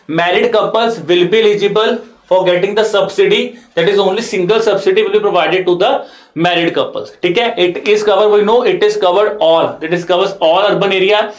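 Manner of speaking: ordinary talk, nothing marked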